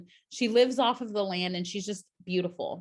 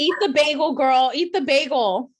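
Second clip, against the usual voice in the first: higher and much louder, egging her on; loud cheer; 8 semitones higher, 10 dB louder than usual. Retold or imitated. Friend loudly urging her friend to eat the bagel, cheering.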